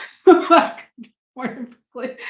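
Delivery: laughing through the words; a real laugh spilling into the line. Real, not acted. Guest telling the host about her birth, laughing.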